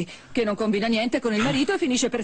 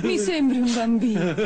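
laughing scorn; 3 dB louder than usual. Acted. Laughs at him.

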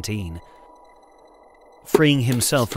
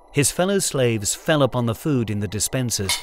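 ordinary delivery

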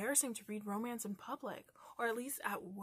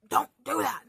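loud, emphatic mock telling-off; playful exasperated outburst; exasperated scolding; 4 semitones higher, 14 dB louder than usual. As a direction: hit each word hard at the offending writer.

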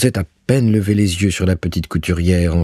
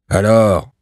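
curt, raised landlord's demand; gruff arrogant voice; 3 semitones higher, 4 dB louder than usual. A narrator's reading. Landlord calling to his tenant through the flat, gruff and haughty. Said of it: "dit une grosse voix rogue".

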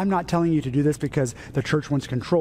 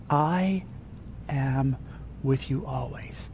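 hushed whisper; 5 dB quieter, slower than usual. Acted. Jesus reassures the believer close at the ear, hushed whisper. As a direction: breathe out each word gently, spaced apart. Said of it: "he whispers into your ear".

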